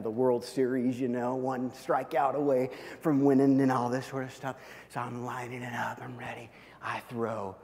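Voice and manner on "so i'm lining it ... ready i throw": hushed, holding breath before the throw; tense whisper. Retold or imitated. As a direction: whisper it like the big moment of a game.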